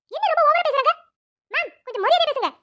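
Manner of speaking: high, loud objection; indignant protest. Acted. Friend stands up to the rude doctor's wife, indignant. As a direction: push back sharply at the insult.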